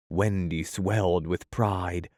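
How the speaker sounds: usual speaking voice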